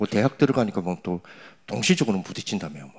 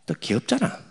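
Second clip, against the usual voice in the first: chuckling through it; amused laugh. Real, not acted. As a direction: laugh it off fondly. Laughing at the world. (웃으며 귀엽다는 말투)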